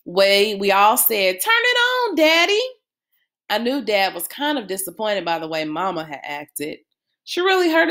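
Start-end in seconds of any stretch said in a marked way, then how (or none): 1.4-2.6 eager begging. Acted. Kids begging Dad together.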